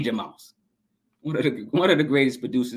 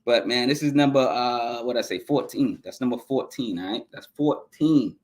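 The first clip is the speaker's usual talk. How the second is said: normal talking voice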